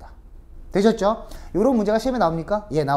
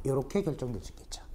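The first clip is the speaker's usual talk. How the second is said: whisper; 5 semitones lower, 12 dB quieter, faster than usual. Real, not acted. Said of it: "unvoiced line".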